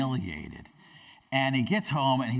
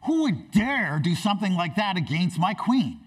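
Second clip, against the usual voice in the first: higher, indignant king's demand; shocked outrage; 5 semitones higher, 4 dB louder than usual. Acted. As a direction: thunder it as an outraged king, hammed up a little. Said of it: "Xerxes is shocked and outraged".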